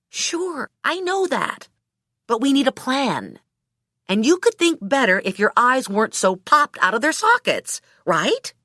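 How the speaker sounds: placating heroine. Acted. Friend tells Hooker they need a plan, placating. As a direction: talk the hothead down.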